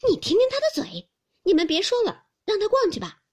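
mock her sharp tongue; a maid jeering at Hongyu, loud and high. Qixian jeering.

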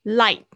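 Light said loud, the final t barely touched; soft final T. Shown as a demonstration. Teacher repeating the word for students, loud with a soft t. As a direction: clip "Light" so its t stays very light. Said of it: "那个T发得很轻".

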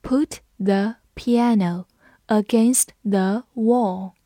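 slow, each word set apart; slow read-along. Shown as a demonstration. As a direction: lead learners through it slowly. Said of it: "跟着我慢读一遍". (放慢速度，一词一顿地领读)

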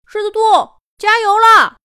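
高声远远地呼喊; 飞在半空冲朋友喊; 大声喊加油. Acted. 小飞鼠在空中喊加油. (小飞鼠在半空为狮子兔大声加油)

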